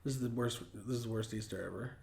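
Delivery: soft, low, worn-out lament; dad with head in hands, quietly defeated. Retold or imitated. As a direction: mumble it despairingly. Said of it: "head in his hands".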